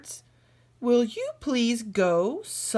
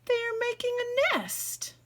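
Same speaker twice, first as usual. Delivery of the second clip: much higher, bright announcement; delighted; 12 semitones higher, 3 dB quieter than usual. Acted. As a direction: squeal it happily. Piggy delighted.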